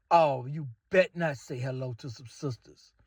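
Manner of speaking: ordinary talk, nothing marked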